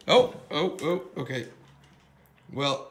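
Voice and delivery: normal talking voice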